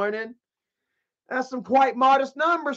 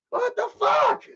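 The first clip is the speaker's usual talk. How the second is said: exasperated yell. Retold or imitated. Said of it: "I'd be like".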